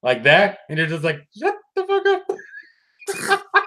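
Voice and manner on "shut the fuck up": high, slow put-on dismissive voice; mock retort. Telling someone making up bullshit to shut up, high silly voice. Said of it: "you just look at them like".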